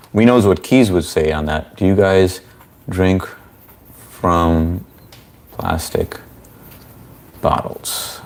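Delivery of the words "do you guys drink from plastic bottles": slow, halting. Real, not acted. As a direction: spell the question out slowly, pausing between words. Slowly repeats the question to chat.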